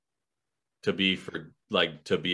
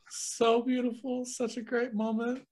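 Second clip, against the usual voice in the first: mock swooning; 4 dB quieter than usual. Co-host gushing over the couple's romantic moment, mock swooning. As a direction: coo over it like a besotted onlooker.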